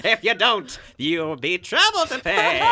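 a hammy cartoon-villain father; Skeletor dad voice. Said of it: "if Skeletor was your dad".

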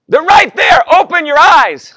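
wife losing patience from downstairs; exasperated wife calling. Retold or imitated.

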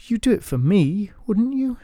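a touch of wheedling charm; coaxing Marjorie. Acted. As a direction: Marjorie sweetly coaxing the knight. Marjorie coaxes.

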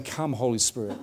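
soft, hushed invocation; quiet prayer. Retold or imitated. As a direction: murmur the prayer patiently. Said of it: "just waiting and saying".

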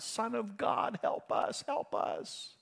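high, thin, drawn-out plea fading to near whisper; plaintive crying out. Acted. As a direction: act a desperate cry for help, stretched and very high. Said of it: "crying like a little baby".

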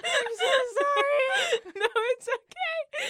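high, squealed words through laughter; laughing hard. Real, not acted. Host apologizing for breaking up the advert, laughing hard. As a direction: apologize while helpless with real laughter. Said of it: "My face hurts from laughing so hard".

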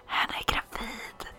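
breathy whisper into the ear; whispered secret. Acted. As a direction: cup the hands and breathe it. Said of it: "Och så bara".

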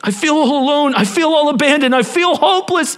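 hammed-up self-pitying Gideon; whiny self-pity. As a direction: mope like a sulking child. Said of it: "He's talking to Gabor".

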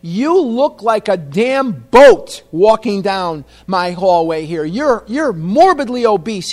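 loud, drawn-out mock insult; yelling dentist. Dentist yelling insults in patient's face, loud mock fury. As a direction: yell it slowly in imitated outrage. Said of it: "he yelled in my face".

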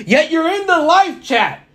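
high-pitched teasing scolding; mock outrage. Co-host ribs Hector for skipping the show, mock outraged. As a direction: playfully tell off an absent friend, pitched high.

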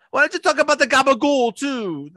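high, fast mock-Italian outburst; over-the-top Italian accent. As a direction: fire it off in a hammy Italian accent. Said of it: "the over-the-top Italian accent".

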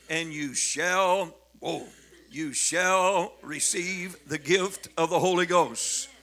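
full-force preaching of Acts; preaching scripture. Acted. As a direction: proclaim the verse to the congregation.

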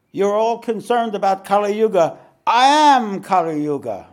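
booming, high proclamation; loud voice. A narrator's reading. Giant declares himself to the frightened sages, loud and high. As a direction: announce yourself loudly to the gathered sages. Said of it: "spoke out in a loud voice".

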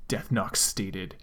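ordinary delivery, nothing marked

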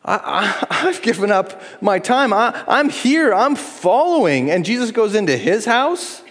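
hammed-up hurt pride; underappreciated whine. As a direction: play the slighted follower, slowing on I'm here. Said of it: "they feel underappreciated".

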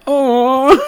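hammed-up nurse sympathy; sympathetic nurse. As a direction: coo like a pitying nurse. Nurse's sympathetic reaction retold.

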